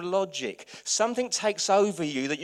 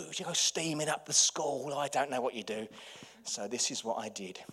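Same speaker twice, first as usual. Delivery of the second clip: ordinary delivery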